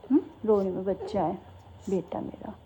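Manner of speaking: calm her down gently; soothing mother. Real, not acted. Mother comforting crying child. (धीमी, कोमल आवाज़ में रोती बच्ची को चुप कराना)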